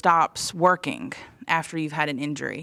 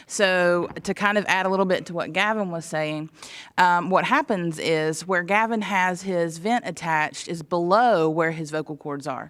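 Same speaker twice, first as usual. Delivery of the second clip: ordinary delivery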